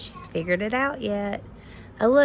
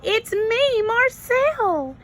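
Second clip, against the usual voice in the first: higher, louder joyful shout; 4 dB louder, slower than usual. Acted. Goose shouts to the butterfly, overjoyed. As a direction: call out in joyful recognition. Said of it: "he shouted".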